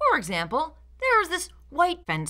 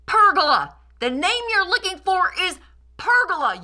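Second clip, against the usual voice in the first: louder, higher, indignant correction; mock outrage; 10 semitones higher, 6 dB louder than usual. Critic correcting hosts about the shade structure, mock-outraged shout. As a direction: bellow the right word at them.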